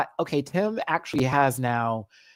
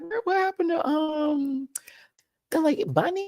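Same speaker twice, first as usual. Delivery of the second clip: high squeaky voicing of friends; mock friends asking. Retelling Jeff and Troy asking about Bunny, high squeaky. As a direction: pipe it up high. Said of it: "They were like".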